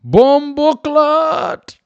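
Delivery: very high, slow, loud mock Jamaican accent; bad Jamaican accent. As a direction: stretch it out in a hammed-up Jamaican accent. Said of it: "Not a very good Jamaican accent".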